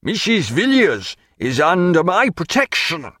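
high, indignant, emphatic claim; furious. A narrator's reading. Canning claiming Missus Villiers to his rival, furious. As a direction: assert it with controlled fury. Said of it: "He was pale with fury".

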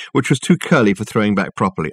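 ordinary delivery

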